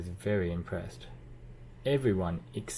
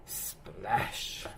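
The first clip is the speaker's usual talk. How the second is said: splash; 4 dB quieter than usual. Acted. Fountain splash sound.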